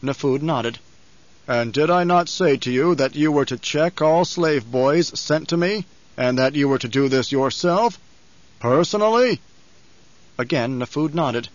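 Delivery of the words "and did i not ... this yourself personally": Baron's measured accusation, rising on the last word; menacing Baron. Acted. Baron rebuking his servant, mounting menace. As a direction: drive the reproach home.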